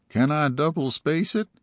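high, cheeky goofy voice; goofy deputy voice. Acted. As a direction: ask it like a lazy wisecracker. Deputy Delbert voiced in story.

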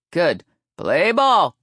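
loud, rising call of the game; big umpire call; umpire's shout. A narrator's reading. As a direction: holler it across the field. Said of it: "she shouted".

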